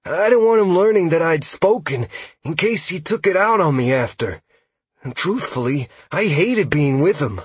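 high, anxious confession; high-pitched, pleading elf voice; shameful admission. A narrator's reading. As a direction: own up, ashamed and scared. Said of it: "Huxley admitted shamefully".

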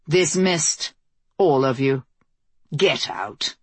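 low, weary command that drops further at the end; stern dismissal. A narrator's reading. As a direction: send them away firmly, pitch sinking. Queen dismisses guards and pages.

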